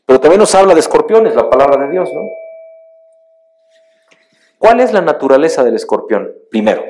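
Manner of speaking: loud, high sermon voice; building to full force at the pulpit; fiery preaching. Acted. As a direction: preach it out to the congregation. Preacher raises voice on scorpions.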